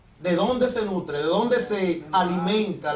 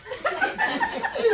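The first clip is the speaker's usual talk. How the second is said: giggle. Retold or imitated. Voiced sister giggles.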